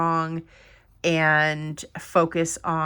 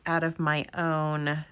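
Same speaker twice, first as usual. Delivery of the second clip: ordinary talk, nothing marked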